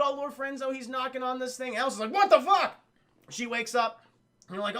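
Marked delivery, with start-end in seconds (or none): 2.1-2.8 high, loud retold shock; startled mock outburst. Alice waking in the tank, hammed-up startled shout. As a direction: blurt it in mock alarm.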